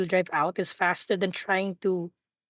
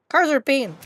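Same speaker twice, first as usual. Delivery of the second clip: normal talking voice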